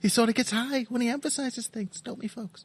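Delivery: a high, dainty caricature; foppish caricature. Pretend. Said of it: "mine's more of a caricature".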